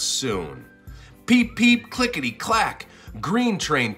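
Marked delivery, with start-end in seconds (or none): none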